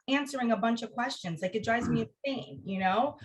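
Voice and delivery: ordinary talk, nothing marked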